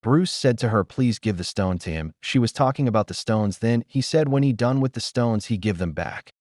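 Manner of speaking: ordinary talk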